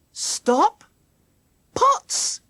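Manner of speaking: high, loud exclamations; excited discovery. A narrator's reading. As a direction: burst out with each word as a discovery. Jackie excitedly finds a clue.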